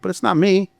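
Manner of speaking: ordinary delivery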